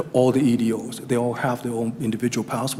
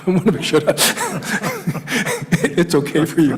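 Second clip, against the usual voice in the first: laughing; 6 semitones higher, 4 dB louder than usual. Real, not acted. Laughing at own accent.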